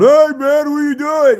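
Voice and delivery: hammed-up loud greeting; too-loud talker. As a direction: shout over your own earbuds. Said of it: "talks real loud".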